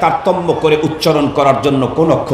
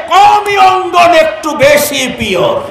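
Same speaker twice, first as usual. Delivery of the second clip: punchline shout; 9 semitones higher, slower than usual. Real, not acted. Preacher praises Qawmi madrasas to the crowd, shouting. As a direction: belt out "একটু বেশি pure" as the triumphant line.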